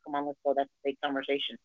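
soft retold aside; low voice. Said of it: "In a normal, low voice".

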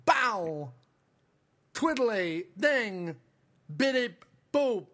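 Walken-style droid beeps; halting, odd robot noises; silly clown. Acted. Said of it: "Christopher Walken is R two D two".

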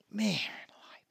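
breathy stunned whisper, almost silent; whispered disbelief. Real, not acted. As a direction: whisper it in shock. Stunned reaction to Alex.

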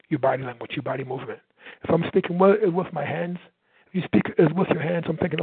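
ordinary talk, nothing marked

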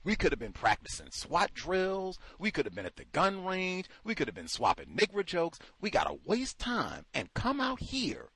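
ordinary talk

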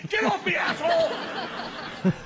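high, drawn-out mock scream; panicked yell. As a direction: imitate someone fighting off a clinging passenger. Cameraman kicking him away.

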